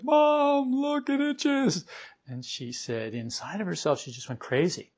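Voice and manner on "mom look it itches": put-on high, slow child's whine; a small boy showing mom his itchy face; little son complaining. Son showing his mother his swollen face, high childish whine. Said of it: "he came and said".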